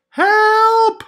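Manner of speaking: high panicked shout; shout for help. Acted. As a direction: yell it out desperately. Naked crayon cries out.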